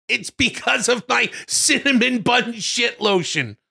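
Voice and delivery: high, slow shouted villain reveal; hammed-up high shout. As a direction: yell it out in a high put-on voice.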